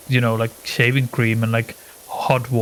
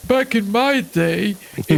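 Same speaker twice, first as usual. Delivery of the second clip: old person impression; 9 semitones higher than usual. Friend mocking their group as old people, high old-timer voice. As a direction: reminisce like a creaky elder, pitched high. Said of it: "three old people going like".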